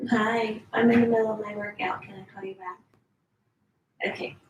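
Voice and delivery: quick, hushed brush-off on the phone; dodging a caller. Real, not acted. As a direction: hurry the caller off quietly. Takes a call mid-workout.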